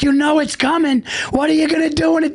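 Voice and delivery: high, teasing coach voice; mock coach warning.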